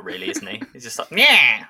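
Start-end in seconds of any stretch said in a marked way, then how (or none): 1.1-1.6 discount Skeletor. Retold or imitated. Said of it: "discount Skeletor".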